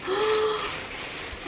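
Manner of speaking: long gasp of surprise; delighted gasp. Real, not acted. Opening a beautiful gift box, surprised gasp. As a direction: gasp at an unexpected present.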